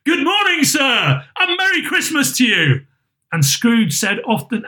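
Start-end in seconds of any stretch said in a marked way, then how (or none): 0.0-2.9 high, bright greeting of jolly strangers; jolly fellows calling out merrily; cheery passers-by. A narrator's reading. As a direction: hail him cheerfully from the street. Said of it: "good-humoured fellows said".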